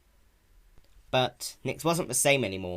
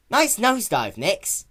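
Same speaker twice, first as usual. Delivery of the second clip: teasing, much higher and louder; joking Spike; 11 semitones higher, 9 dB louder, slower than usual. A narrator's reading. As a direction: poke fun at her tumble. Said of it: "Spike joked".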